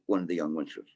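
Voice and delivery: normal talking voice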